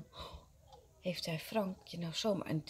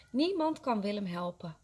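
ordinary delivery, nothing marked

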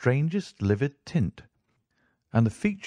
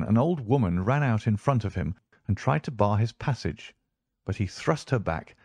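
ordinary delivery

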